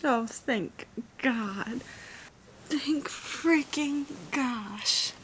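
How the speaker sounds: long drawn-out, relieved words; huge relief. Real, not acted. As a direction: stretch each word out in sheer relief. Reader learns the character lives.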